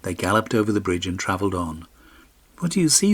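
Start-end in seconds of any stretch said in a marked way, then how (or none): 2.6-3.1 much higher, light pony voice; talking pony. A narrator's reading. Magic pony asking its rider, high and light.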